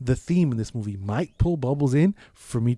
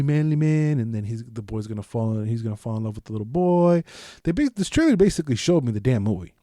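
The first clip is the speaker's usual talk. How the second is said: usual speaking voice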